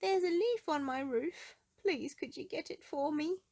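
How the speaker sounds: high, anxious calling voice; Mister Worry. Acted. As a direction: call up to her, fretful and pleading. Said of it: "he called out to her".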